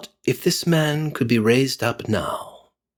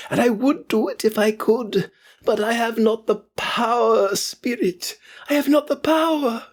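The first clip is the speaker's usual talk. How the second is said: put-on high, anguished pleading old man; despairing Scrooge; 13 semitones higher than usual. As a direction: confess helplessness in anguish. Scrooge despairs before the ghost.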